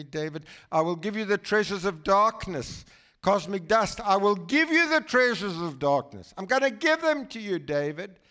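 high, loud and booming divine voice; voice of God. Retold or imitated. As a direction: proclaim it like God speaking from on high. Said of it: "And God said to me".

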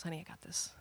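very soft, low and calm; quiet reassurance. Retold or imitated. Husband calming fired-up wife, quietly. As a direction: gently take over, almost under the breath. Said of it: "Josh just kinda quietly puts his arm".

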